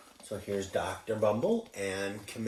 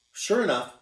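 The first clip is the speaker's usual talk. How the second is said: plain reading voice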